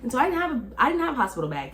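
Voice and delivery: ordinary delivery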